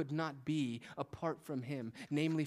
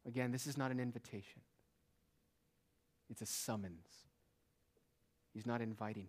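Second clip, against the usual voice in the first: ordinary talk, nothing marked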